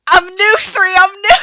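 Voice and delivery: high, loud, crying-out joy; high excited cry; excited squeal. Retold or imitated. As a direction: squeal it like a newborn thrilled by the world. Character thrilled, newly free.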